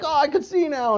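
high, excited exclamation; amazed delight. Acted. Healed blind person discovering sight, amazed delight. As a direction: marvel at a sudden cure.

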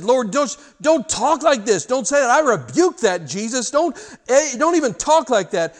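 loud, high, urgent protest; Peter protesting. Acted. Peter rebuking Jesus for talk of suffering, loud and high.

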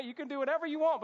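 high, loud, rapid-fire lecture; scolding dad. Retold or imitated. Dad lecturing his lying son, fast loud acted scolding. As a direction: rattle it off as the exasperated father. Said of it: "my dad giving me a lecture".

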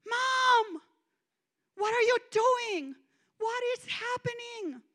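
high, loud desperate call; panicked high-pitched yell; yelling in terror. Retold or imitated. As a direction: cry out to mom across a stadium. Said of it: "I began to yell at her".

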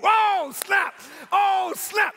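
high, exclaimed astonishment; thrilled, high-pitched reaction; amazed outburst. Retold or imitated. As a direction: burst out like stunning news just hit. Acts out amazed reaction to God.

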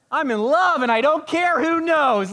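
high, loud, gushing elf shout; loud giddy Buddy the Elf. Retold or imitated. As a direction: burst out like a giddy elf in love. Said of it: "I feel like I'm Elf, Buddy the Elf".

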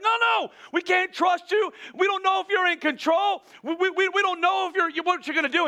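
high, frantic mock protest; freaking out. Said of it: "They're freaking out".

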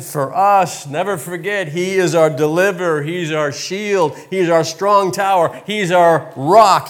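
rising emphatic preaching litany. Acted. Pastor rallying the congregation, emphatic preaching. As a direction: build each name of God with conviction.

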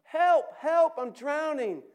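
frantic high scream for help; desperate drowning cry; shout. Acted. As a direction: yell as if going under. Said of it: "hears your cries".

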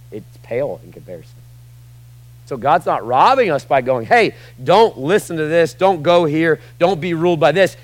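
loud, high, driving sermon voice; preaching at full force. Acted. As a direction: hammer it home to the church.

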